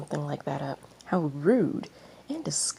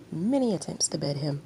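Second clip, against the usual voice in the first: ordinary delivery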